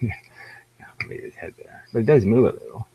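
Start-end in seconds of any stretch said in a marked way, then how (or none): 1.0-1.8 breathy whispered aside; muttered whisper under the breath. Real, not acted. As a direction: drop to a whisper, just to yourself. Aside while fiddling with avatar.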